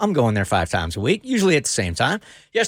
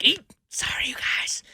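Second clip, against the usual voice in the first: whisper; 11 semitones higher, 7 dB quieter than usual. Retold or imitated. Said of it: "I'm like".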